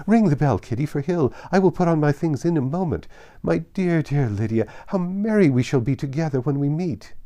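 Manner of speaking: high, loud, breathless delight; mother rattling off wedding plans in a high, loud rush; joyful gushing. A narrator's reading. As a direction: bubble over with wedding excitement. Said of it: "her joy burst forth".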